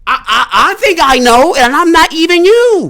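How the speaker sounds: high, loud exasperated talk with laughs; loud amazed laughing outburst; loud laughing rant. Real, not acted.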